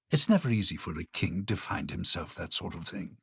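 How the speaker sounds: ordinary delivery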